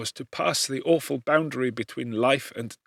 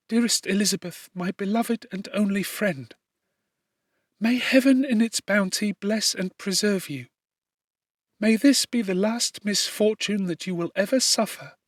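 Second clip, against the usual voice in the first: higher, holding back tears; half-suppressed emotion; 7 semitones higher than usual. A narrator's reading. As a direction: bless her while fighting tears. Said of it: "in a voice of half-suppressed emotion".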